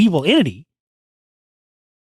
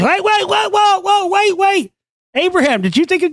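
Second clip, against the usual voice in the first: high panicked voice; 11 semitones higher than usual. Retold or imitated. Panicked worshipper in acted scene.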